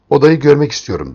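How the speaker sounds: stern demand. A narrator's reading. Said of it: "dedim sertçe".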